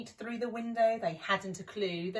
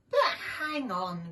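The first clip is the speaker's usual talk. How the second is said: groaning; 3 semitones higher, 4 dB louder than usual. Acted. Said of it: "groaned Shifty".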